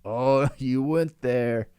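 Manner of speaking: mock guilty giggle; guilty laugh. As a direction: chuckle out the words as if caught off guard. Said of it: "They're just like".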